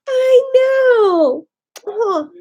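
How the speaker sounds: sobbing, voice breaking; real tears spilling over; crying out over the pets. Real, not acted. Emotional moment with pets.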